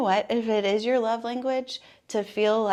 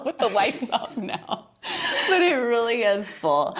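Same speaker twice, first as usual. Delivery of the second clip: laughing; 2 semitones higher than usual. Real, not acted. Laughing about the dark tree.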